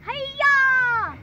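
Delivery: loud high yell; shout. Real, not acted. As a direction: yell it out as you throw. Throwing bread to the duck.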